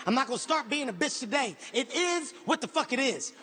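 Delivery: ordinary delivery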